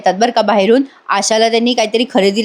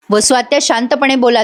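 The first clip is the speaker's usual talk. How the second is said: ordinary delivery, nothing marked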